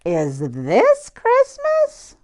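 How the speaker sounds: slow, high, wondering question; baby hippo asking in wonder; hopeful little hippo. A narrator's reading. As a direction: stretch it out full of hope. Fiona asks again in story.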